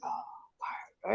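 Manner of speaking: soft, whispery tired sigh of words. Retold or imitated. Friend sitting down to dinner at Jollibee's, tired. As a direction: imitate someone worn out, barely voiced. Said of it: "he looked really tired".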